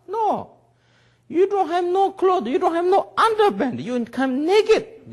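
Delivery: put-on high, loud lecture to the boy; mock scolding. Teacher telling the young man off, loud and high. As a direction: hammer it home, half laughing at him.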